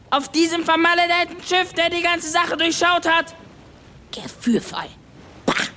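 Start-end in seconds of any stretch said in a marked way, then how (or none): 4.1-5.6 dropped low, dismissive scoff; scornful. Acted. Klaus mocks Sven's advice, low scornful aside. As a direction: spit the word back with contempt.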